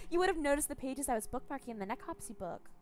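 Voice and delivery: high, loud contemptuous scolding; sneering madwoman. Acted. Captor mocking Gregor's ignorance, high sneering voice. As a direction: talk down to him like an idiot.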